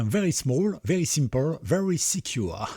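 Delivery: hammed-up English accent, drawn out at the end. Shown as a demonstration. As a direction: put on an English accent with a French speaker's touch. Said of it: "Avec l'accent anglais en plus".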